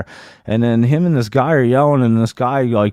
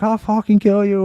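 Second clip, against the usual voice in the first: retold threatening yell; 10 semitones higher than usual. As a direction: imitate an angry threat in a high voice. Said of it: "yelling at the guy".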